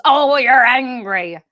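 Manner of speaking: slow, sneering put-on voice; mocking projection. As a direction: mimic the condescending accuser, slow and loud. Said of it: "the projections".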